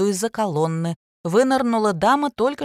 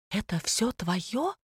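slow, soft, higher-pitched wonder; awestruck; 4 semitones higher, 7 dB quieter, slower than usual. A narrator's reading. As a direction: ask softly and slowly, overawed by the house. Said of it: "обрела дар речи".

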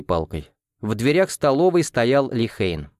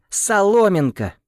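slow, high, loud shout of the name; angry shout; 11 semitones higher, 5 dB louder, slower than usual. Acted. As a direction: yell the name in fury. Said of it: "вспыхнула Соломенко".

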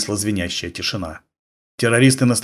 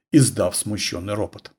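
plain reading voice

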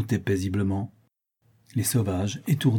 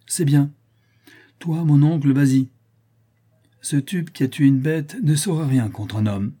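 louder, higher challenge; defiant; 5 semitones higher, 4 dB louder than usual. A narrator's reading. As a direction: dare the old man. King's son challenges his uncle.

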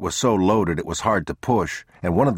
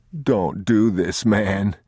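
drawn-out, slightly raised plea; pleading; 3 semitones higher, much slower than usual. Acted. Stripped thief begs the man not to do this, pleading. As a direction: plead slowly for mercy.

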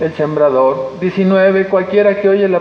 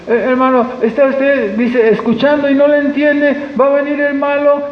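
mock warning; 8 semitones higher than usual. Preacher voices a warning brother.

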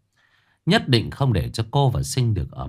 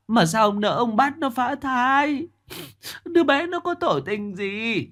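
much higher, begging voice of a kneeling wife; desperate pleading; 12 semitones higher than usual. Acted. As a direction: plead on your knees for the unborn child. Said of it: "bà quỳ thụp xuống van xin".

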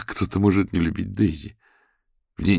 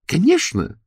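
high, loud "of course" exclamation; exclaimed as obvious; 12 semitones higher, 6 dB louder, slower than usual. A narrator's reading. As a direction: toss it out as utterly obvious. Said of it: "воскликнула Молли таким тоном, словно это было очевидно".

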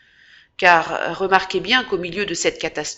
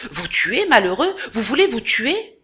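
horrified; 6 semitones higher, 4 dB louder than usual. A narrator's reading. Boy horrified by the pistol.

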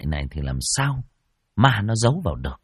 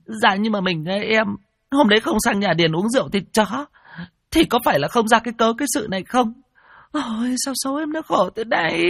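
much higher, whiny lament of a wife; whining and wailing; 12 semitones higher than usual. Acted. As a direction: wail over her husband's fate. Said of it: "ỉ ôi khóc lóc". (giọng cao rên rỉ khóc lóc)

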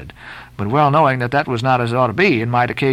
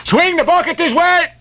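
high, urgent called order; high shouted order; 14 semitones higher, 3 dB louder than usual. A narrator's reading. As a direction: shout the command over the chaos. Sailor shouting orders in crisis.

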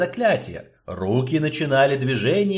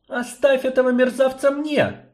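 put-on fierce young sorceress; 13 semitones higher than usual. Eltara's high voice.

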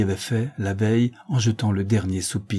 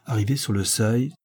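plain reading voice

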